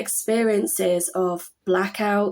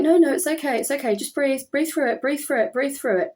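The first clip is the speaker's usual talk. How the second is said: higher, mock calming coach voice; mimicked nurse coaching; 6 semitones higher, faster than usual. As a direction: lightly imitate staff urging her to breathe. Staff coaching her breathing.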